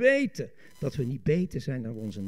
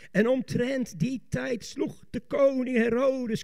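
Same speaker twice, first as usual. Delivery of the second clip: slow, raised preacher's reading; declaimed scripture; 7 semitones higher, 4 dB louder, slower than usual. Real, not acted. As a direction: proclaim the verse with weight. (plechtig voorlezend met stemverheffing)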